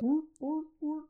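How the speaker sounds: high, barking seal calls; a sudden high seal bark; seal impression. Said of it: "an impression of a seal".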